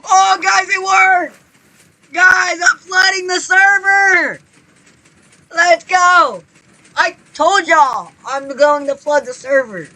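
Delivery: high, loud, excited yelling; triumphant shouting. Real, not acted. Gamer's flood finally works, triumphant excited shouting. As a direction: shout your victory to the viewers.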